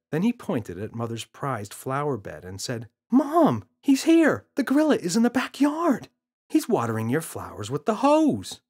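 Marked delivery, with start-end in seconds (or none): 3.1-8.6 put-on excited child. Boy telling mother.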